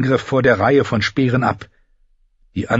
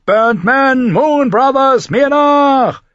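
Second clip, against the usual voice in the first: very high, loud battle cry; rallying shout; 5 dB louder, much slower than usual. A narrator's reading. Tyrion calling the Burnt Men and Moon Brothers to follow, a loud battle cry. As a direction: call the clans to follow you into the breach. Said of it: "Tyrion rief".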